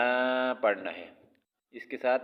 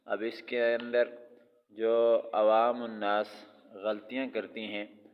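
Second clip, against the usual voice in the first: normal talking voice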